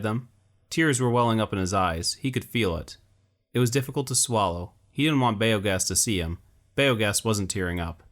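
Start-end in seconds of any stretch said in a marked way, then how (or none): none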